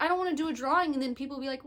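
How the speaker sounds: ordinary talk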